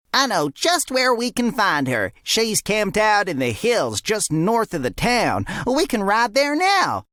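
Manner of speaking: high, keen little deputy voice; bright helpful sidekick, slow and sure; eager deputy. A narrator's reading. Fred's voice.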